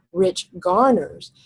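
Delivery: rich and garners both stressed; spondee stress. Shown as a demonstration. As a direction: hit rich garners evenly hard. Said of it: "called a spondee, where both syllables are accented".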